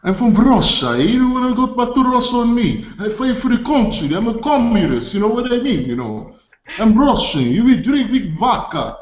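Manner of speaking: put-on high-pitched comic Russian accent. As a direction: play a proud Russian in a hammed-up accent. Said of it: "I also do a pretty good Russian impersonation".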